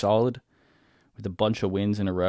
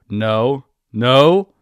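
panicked no, getting louder; rising shout; 3 semitones higher, 8 dB louder than usual. Real, not acted. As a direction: shout it as it slips away. Unit about to be defended.

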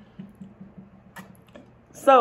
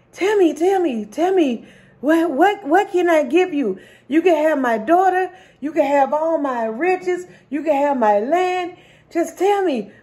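pleading king; 6 semitones higher than usual. Acted. King begs the swimmer to tell him how, high and urgent. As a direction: press him over and over for the secret. King begging to know the secret.

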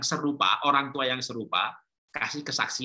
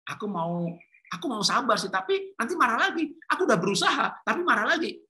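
put-on frustrated teen's plea, climbing high; acted frustration; 8 semitones higher than usual. Rebellious child who keeps failing to change, acted frustration. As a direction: voice a frustrated child, pitch rising as the attempts keep failing. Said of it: "Bahkan dia frustasi".

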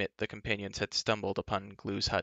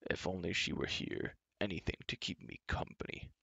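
soft, half-voiced giant's lament; wistful cry; 3 semitones lower, 9 dB quieter than usual. A narrator's reading. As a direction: pine quietly for company. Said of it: "cried Glue".

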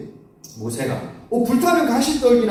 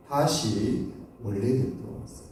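ordinary talk, nothing marked

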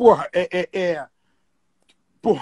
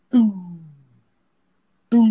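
low round tu; vocal tom before the tech; good tom sound; 3 semitones lower than usual. Shown as a demonstration. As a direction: hum the tom deep. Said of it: "antes o som dele era".

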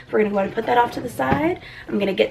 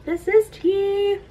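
delighted gasp; 9 semitones higher than usual. Real, not acted. Finding tea in box.

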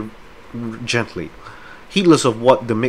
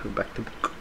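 a soft whisper, almost no voice; whispered aside to himself; 14 dB quieter than usual. Real, not acted. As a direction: murmur it under your breath. Repeats the reminder under his breath.

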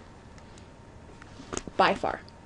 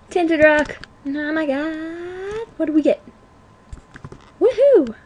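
high-pitched thrill with stretched words; excited; 6 semitones higher than usual. Real, not acted. Gamer spotting a tinted rock and its reward, high and excited. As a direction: squeal over the lucky find, then whoop.

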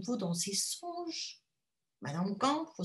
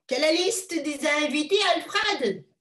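eager wife; 6 semitones higher, 7 dB louder than usual. Acted. Wife asks her husband.

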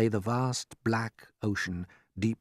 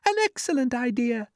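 approving ladybird; 4 dB louder than usual. Acted.

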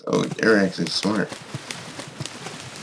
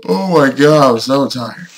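louder, higher exhausted outburst; tired yelling; 3 semitones higher, 5 dB louder than usual. Real, not acted. Worn-out player yelling while waiting on the game, loud exasperated cry. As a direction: let the frustration burst out. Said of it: "You can tell from that yelling".

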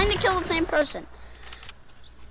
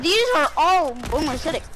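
usual speaking voice

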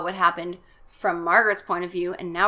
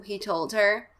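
ordinary delivery

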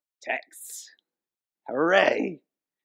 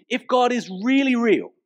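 higher, louder imitation of a doubting voice; mock the insistent sceptic; skeptical questioner; 10 semitones higher, 5 dB louder than usual. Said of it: "People say to me".

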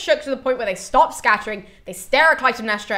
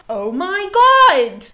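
high, loud, slow gasp of horror; mock shock; 9 semitones higher, 8 dB louder, much slower than usual. Chorus reacting to Agamemnon's cries, loud mock shock. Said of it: "the chorus are like".

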